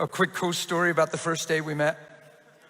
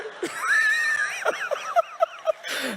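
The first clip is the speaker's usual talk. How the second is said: high burst of laughter. Real, not acted. Laughing at the story.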